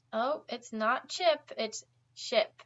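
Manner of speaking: slow, with weight on "chip" and then on "ship"; corrective contrast. Shown as a demonstration. Teacher correcting a student who mixed up "chip" and "ship". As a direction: set "ship" against "chip" to fix the error.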